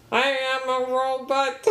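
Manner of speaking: flat mechanical robot monotone; toy robot voice. Retold or imitated. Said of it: "he goes".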